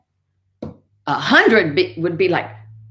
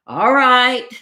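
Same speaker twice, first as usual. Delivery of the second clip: mildly exasperated, higher-pitched piglet; sighed; 7 semitones higher, 4 dB louder than usual. Acted. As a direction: give in with a drawn-out reply. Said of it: "sighed the piglet".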